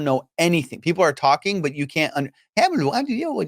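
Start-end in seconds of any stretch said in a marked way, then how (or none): 2.6-3.4 high-pitched mock English gibberish; garbled English. Immigrant kid hearing strangers talk English, garbled mock babble. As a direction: imitate English as meaningless babble. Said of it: "that's what it sounded like to me".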